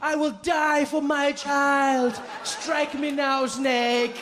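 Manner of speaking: very slow, high, grand self-sacrificing declaration; a mother daring the snake to strike; heroic mother. Retold or imitated. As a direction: melodramatic mom vowing to die for her child. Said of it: "she'd be like".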